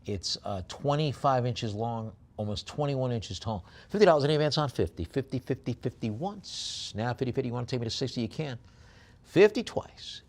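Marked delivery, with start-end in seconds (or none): 3.9-10.2 quick, lifted bid-calling, drawing out the going calls; auctioneer's call. Acted.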